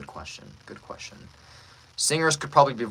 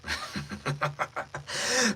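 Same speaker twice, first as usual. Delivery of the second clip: performed villain chuckle; villain laugh; 5 semitones higher, 4 dB quieter than usual. Acted. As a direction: chuckle darkly into the line. Villain laugh before the line.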